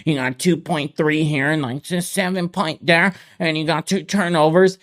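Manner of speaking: lightly voiced bro reading stats; mock stat-reciting fan. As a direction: rattle off stats like a bar fan. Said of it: "they're reciting stat sheets".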